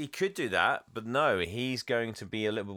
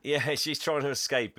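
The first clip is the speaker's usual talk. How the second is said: usual speaking voice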